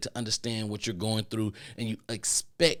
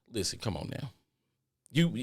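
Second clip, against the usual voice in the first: ordinary talk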